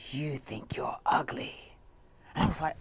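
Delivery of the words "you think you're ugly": slow, low whisper; whispered inner voice. Acted. Her mind talking to her as she walks, slow whisper. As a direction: whisper it slowly, like a nagging thought. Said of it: "my mind was talking to me".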